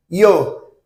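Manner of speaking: ordinary talk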